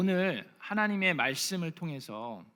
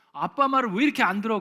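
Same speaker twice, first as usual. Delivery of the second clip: scolding dad; 5 semitones higher, 8 dB louder, faster than usual. Retold or imitated. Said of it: "그러면 아이들이".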